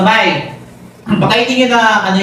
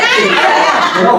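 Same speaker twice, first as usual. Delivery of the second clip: laughing mid-count; 8 semitones higher, much slower than usual. Real, not acted. Coach cracking up while counting for dancers, laughing. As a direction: count dissolving into a high laugh.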